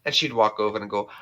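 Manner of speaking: usual speaking voice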